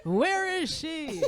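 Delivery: slow, high, stretched-out question, loud then easing off; mock frightened child. Comedian playing son watching for tooth fairy, mock fear. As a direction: imitate a kid searching in dread.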